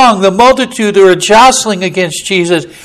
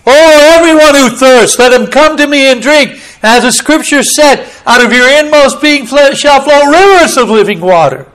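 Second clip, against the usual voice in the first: higher and louder, calling over a crowd; raised voice; 6 semitones higher, 5 dB louder than usual. Acted. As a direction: proclaim it so the back row hears. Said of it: "he raised his voice and said".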